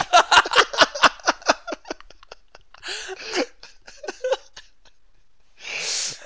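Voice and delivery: high, loud laughing fit with crying; laughing to tears; helpless laughter. Real, not acted.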